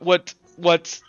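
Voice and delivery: ordinary talk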